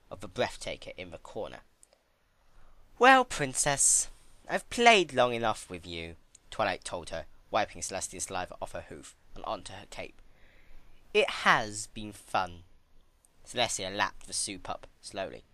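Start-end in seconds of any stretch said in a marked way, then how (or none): 3.0-6.2 slow, drawn-out menacing Well; menacing Twilight. Acted. Twilight telling the princess playtime is over, menacing. 11.1-12.6 slow, lightly mocking cold delight; a hint of cold Twilight. Twilight to the captive princess, lightly cruel. Twilight coldly ends the game.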